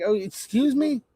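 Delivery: high, incredulous "excuse me"; mock disbelief. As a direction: play it as a shocked double take. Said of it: "I'm like".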